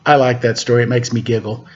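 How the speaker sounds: ordinary delivery, nothing marked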